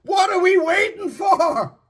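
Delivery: God calls out urgently to his people; God's cry. Acted. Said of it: "who still cries to us".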